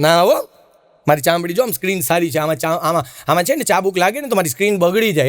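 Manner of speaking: a hint of a fussy sinner begging off; mock whiny refusal. Dead man fussing over his skin.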